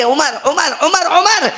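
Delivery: calling out. Acted. Abu Bakr runs after Umar, calling his name, high and rising. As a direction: call after him as you run, pleading.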